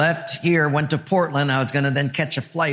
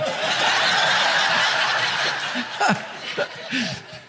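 laughing; 7 semitones higher than usual. Real, not acted. Laughs at own punchline.

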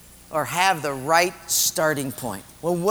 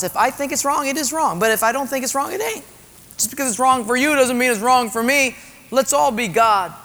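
self-assured defiance; 8 semitones higher, 4 dB louder than usual. Acted. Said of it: "we start to think that".

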